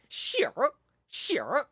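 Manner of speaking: "sure" high, in a mainland Chinese accent with a curled r; mainland Chinese word. Shown as a demonstration. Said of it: "in China, you say".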